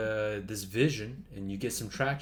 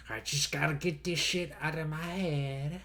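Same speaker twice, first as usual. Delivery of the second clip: higher nasal-free nerdy voice; nerd voice; 5 semitones higher than usual. Acted. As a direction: blurt it like a geeky nerd. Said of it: "I did the nerd voice accidentally".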